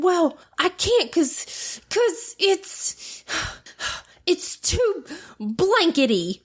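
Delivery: put-on high, slow, grudging little-boy whine; whiny son. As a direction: drag out a tired child's excuse. Son's grumpy excuse retold.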